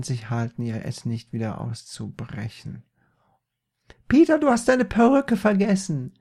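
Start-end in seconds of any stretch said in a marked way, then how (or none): 4.1-6.1 very high, loud mother voice; mother calling out. Acted. Mother runs after son, high loud call. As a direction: shout after someone leaving.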